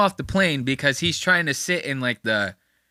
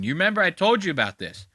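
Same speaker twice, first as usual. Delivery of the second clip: normal talking voice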